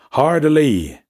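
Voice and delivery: loud, high ship's order. Acted. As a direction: call it out across the deck. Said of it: "his raised voice".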